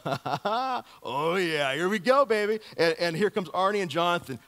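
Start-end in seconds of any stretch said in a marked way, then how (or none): 0.1-2.6 higher, louder excited whoop; gleeful hero itching for the fight; Jonathan's eager reply. Retold or imitated. Preacher voices Jonathan thrilled at the Philistines' dare. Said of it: "Jonathan's eyes go".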